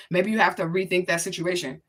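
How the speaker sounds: normal talking voice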